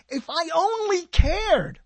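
high, slow, sing-song sarcastic line; mock sarcasm. Host mocking his own complaint about Microsoft, high and slow.

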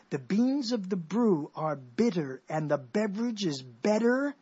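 slow, measured "beans of the brew"; slow tongue twister. Shown as a demonstration. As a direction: roll out the B words slowly. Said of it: "a tongue, a twister".

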